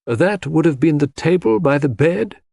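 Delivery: lightly played coroner prompting a witness; questioning coroner. A narrator's reading. Coroner checks a detail with Mary, mildly questioning.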